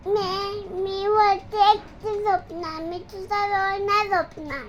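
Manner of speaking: loud sulky declaration. Real, not acted. Sulking child declares she sleeps alone.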